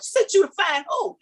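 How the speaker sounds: high, flabbergasted retold question; freaking out. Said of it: "I was freaking out".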